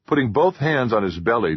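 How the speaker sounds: plain reading voice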